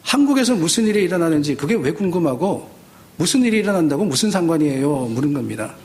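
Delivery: ordinary talk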